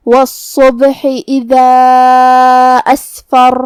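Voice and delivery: very slow chant with a heavy doubled ص in والصبح; measured Quranic chant; tajweed recitation. Shown as a demonstration. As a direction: draw it out melodically. Recites next verse.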